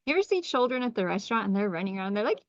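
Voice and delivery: normal talking voice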